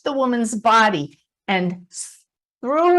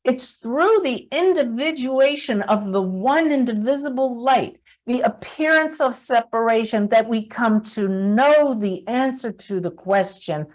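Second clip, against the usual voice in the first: normal talking voice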